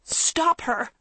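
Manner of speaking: high, strained cry for help; choked, desperate plea. A narrator's reading. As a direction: force it out in panic. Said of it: "Maggie choked out".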